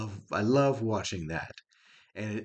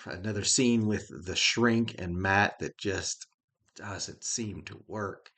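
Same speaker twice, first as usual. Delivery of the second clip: ordinary talk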